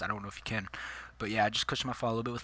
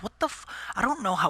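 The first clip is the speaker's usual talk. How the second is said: much higher incredulous What the f; high, disbelieving outburst; exasperated; 10 semitones higher than usual. Retold or imitated. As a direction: react in disbelief at the question. Absurd pain-rating question.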